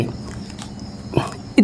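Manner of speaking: breathy whispered aside. Real, not acted. Teacher whispers to class to look. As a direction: drop to a whisper to draw attention.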